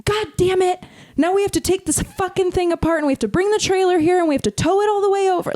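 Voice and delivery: high, fast, exasperated mock rant; angry instructor rant. Instructor griping to student about towing the glider, angry mock rant. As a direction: play the grumpy instructor griping about the hassle. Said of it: "he goes".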